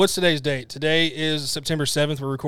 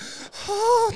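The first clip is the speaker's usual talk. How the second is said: dramatic gasp. Retold or imitated. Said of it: "dramatic and shit".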